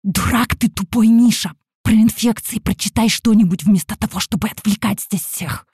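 loud, furious stage whisper; angry hiss. A narrator's reading. Teacher turning on a pupil during rehearsal, angry hiss. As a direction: hiss it like a viper at a mongoose. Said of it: "Зашипела я вдруг на него".

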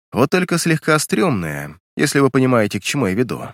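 ordinary delivery

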